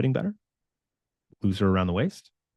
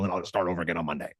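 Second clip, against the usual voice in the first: fast resigned mumble; 7 semitones lower, 6 dB quieter, much faster than usual. Pretend. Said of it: "Instead of".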